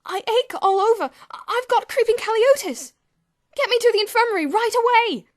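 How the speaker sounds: very high, loud panic; panicked. A narrator's reading. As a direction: plead in terror for help. George panics, believes he is dying.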